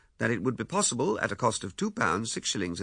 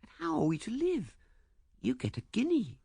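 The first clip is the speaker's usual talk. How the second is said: high, light questioning voice; high and doubting; doubtful woman's voice; 13 semitones higher than usual. A narrator's reading. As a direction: voice a worried young woman, pitched high. Woman doubts his plan.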